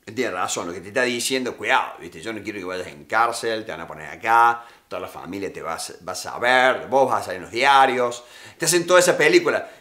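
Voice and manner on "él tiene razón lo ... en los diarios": good cop. Retold or imitated. Good cop soothes the suspect, lower and softer. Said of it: "Y el bueno dice".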